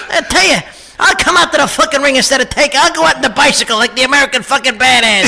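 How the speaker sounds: fast, foul-mouthed wiseguy tirade; Joe Pesci impression. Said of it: "Pesci's my favorite to do".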